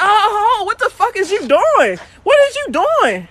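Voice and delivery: high-pitched, mock-alarmed outcry; retold shocked protest. Mechanic retelling her reaction to bathroom intruder, high shocked protest. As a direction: act out a high, startled protest.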